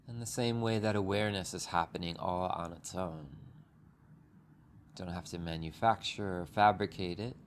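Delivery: unhurried meditation guide with long pauses; calm, even voice, leaving space between phrases; guided meditation. Acted. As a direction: ease listeners gently through breath and body.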